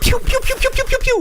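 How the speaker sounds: rapid high, loud "pew" shots; gunfire noises. Retold or imitated. Hitman shooting at attacking teenagers, high loud mock gunfire. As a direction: fire off cartoon gun sounds.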